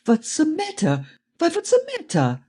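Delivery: put-on very high, worried German-accented voice. A narrator's reading. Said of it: "in a strong German accent".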